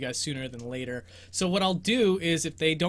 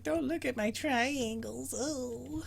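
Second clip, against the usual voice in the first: put-on embarrassed girl voice; 8 semitones higher, 7 dB quieter, slower than usual. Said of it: "She's like".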